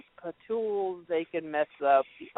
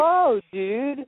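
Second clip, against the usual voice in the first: pitched-up, louder "whoa dude" recoil; taken aback; 8 semitones higher, 4 dB louder than usual. Retold or imitated. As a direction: react startled at the foul mouth. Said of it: "I was like".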